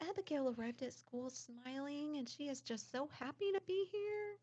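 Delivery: a touch of sweet, high teacher voice; lightly voiced chirpy teacher. Teacher texting mom about Abigail, mildly sweet and high. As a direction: lightly mimic the cheerful teacher. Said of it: "She's like".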